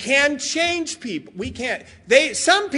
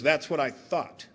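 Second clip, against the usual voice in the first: dry detective; 9 semitones lower, 9 dB quieter than usual. Retold or imitated.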